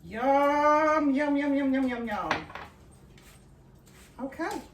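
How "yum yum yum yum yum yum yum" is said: excited yum. Real, not acted. Tasting butter buns, excited.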